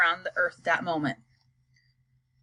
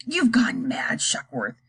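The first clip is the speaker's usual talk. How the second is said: plain reading voice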